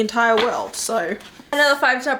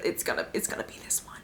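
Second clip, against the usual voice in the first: soft whispered confession, much softer; whispered dread; 6 semitones lower, 13 dB quieter, faster than usual. Retold or imitated. Admitting to viewers which book she'll skip, whispered. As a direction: whisper it like a guilty secret. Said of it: "I'm putting this book on here and I'm like".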